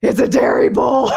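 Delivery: alarmed cry. Retold or imitated. Seeing the bull is a dairy bull, alarmed cry.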